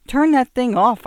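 loud, high urgent order; alarmed command. Acted. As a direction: snap it out in alarm. Alarmed wife to husband.

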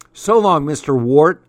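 a gloating send-off growl; playful snarl of farewell. A narrator's reading. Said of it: "I growled".

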